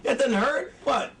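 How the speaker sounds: high-pitched mock disbelief; incredulous viewer. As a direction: heckle the actor in exaggerated outrage. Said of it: "you'd be like".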